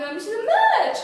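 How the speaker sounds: high, loud lovesick swoop. Acted. Demonstrating how lovers sound on the phone, swooping pitch. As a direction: exaggerate the rise and fall, mock pining. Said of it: "your voice goes up and down even".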